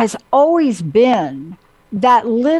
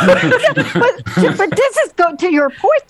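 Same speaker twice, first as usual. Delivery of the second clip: high laughing voice; 8 semitones higher, slower than usual. Real, not acted. To Jenna, still laughing at the pizza story. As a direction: laugh your way back into the point.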